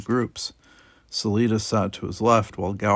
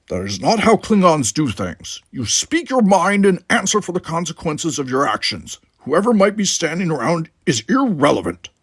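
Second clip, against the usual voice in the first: louder, higher forceful Klingon voice; Klingon conviction; 7 semitones higher, 6 dB louder than usual. Acted. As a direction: declare his code proudly. Worf's forceful reply.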